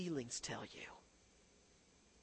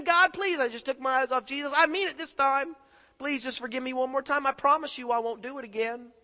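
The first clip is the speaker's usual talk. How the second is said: high, loud desperate pleading; preacher voicing a sinner begging God for one more chance; imitated begging prayer; 10 semitones higher, 12 dB louder than usual. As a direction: plead like you mean it this time. Voices a begging sinner again.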